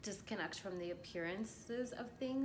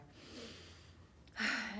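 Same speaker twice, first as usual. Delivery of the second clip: long, voiceless exhale; relaxed sigh to settle in; long settling sigh; 3 semitones higher than usual. Real, not acted. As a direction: breathe out slowly and audibly. Settling in to get comfy.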